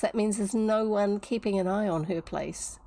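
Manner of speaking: usual speaking voice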